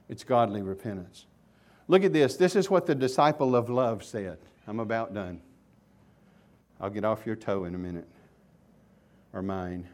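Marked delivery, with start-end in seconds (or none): none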